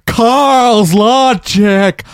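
slow drawn-out mimic voice; imitating a friend. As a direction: stretch it out like a catchphrase. Imitating Carl's catchphrase.

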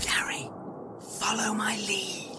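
secretive whisper, barely voiced. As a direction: whisper it so the captor can't hear. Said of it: "What are you whispering about?".

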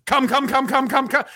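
high, rapid repetitive chant; mock an impatient listener, high-pitched; hammed-up high pleading chant; imagined eager listener. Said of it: "This is how I picture someone listening".

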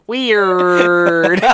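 loud, stretched-out imitation of a character's one word; long, loud mock line reading; drawn-out quote. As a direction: mimic the character, dragging the word out loudly. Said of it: "locked eyes with me and said".

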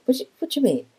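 a light, high-pitched Australian "what do you mean?"; mock-Australian puzzled question; Australian accent. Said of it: "In an Australian accent".

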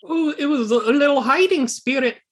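high squeaky voice of the odd companion; Talks to herself voice. Acted.

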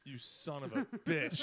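slow curse through laughter; laughing curse. Retold or imitated. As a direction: curse him while laughing. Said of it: "He's like".

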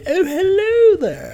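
very high, loud, drawn-out posh greeting; hammed-up posh English, stretched and piercingly high; overly posh accent. Showing co-host the accent he dislikes, overly posh accent. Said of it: "overly posh accents".

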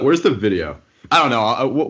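ordinary delivery

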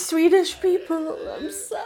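loud, high plea tailing into a whimper; mock apology. Co-host apologizing to Swedish people for the joke, mock wail. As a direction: beg forgiveness, hammed-up and high.

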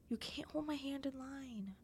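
put-on breathy whisper of a nervous kid; secret whisper. Kid warning her boyfriend in the school line, whispered. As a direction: hiss it so nobody hears. Kids hiding hand-holding from teacher.

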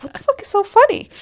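high, quick exasperated question; baffled annoyance. Retold or imitated. Acting out her reaction to friends laughing outside, baffled. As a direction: squeak it out fast, mock-exasperated.

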